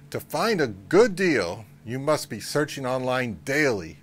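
normal talking voice

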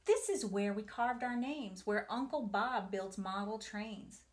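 ordinary delivery

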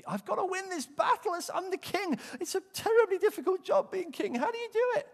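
very high, loud whiny king; stressed king. Pretend. Saul fretting over the battle, very high loud whine.

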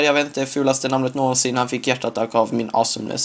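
usual speaking voice

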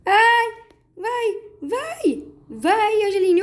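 high, loud thrilled urging; excited high cheering. Real, not acted. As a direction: cheer yourself on down the slide. Cheering herself down the slide. (torcendo animada, aguda e alta)

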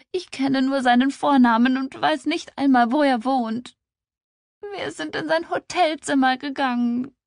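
high, loud tearful outpouring; sobbing. A narrator's reading. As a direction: wail the confession through acted sobs. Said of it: "Sie schluchzte auf". (schluchzend, hoch und verzweifelt)

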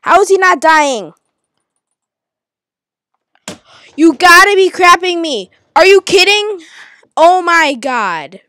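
loud, high, exasperated outburst; furious yelling. Real, not acted. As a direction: blow up at the game. Raging at losing a fight.

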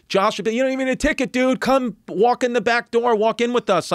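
high, welcoming voice; friendly host. Retold or imitated. Owner's family invites the comic in, high and friendly. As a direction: wave him in like old friends.

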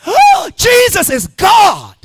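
loud, high, drawn-out ecstatic shout; screaming revelation. Retold or imitated. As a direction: scream it out like a sudden discovery. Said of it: "I just started screaming".

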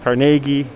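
kar-NAY-ghee, held up as one choice; offered pronunciation option. Shown as a demonstration. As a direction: say Carnegie with a long "nay" in the middle. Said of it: "you choose how you wanna".

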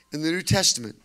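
ordinary delivery

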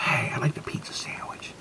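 secret whisper. Shown as a demonstration. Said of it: "Secretly go in there".

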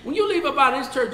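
ordinary talk

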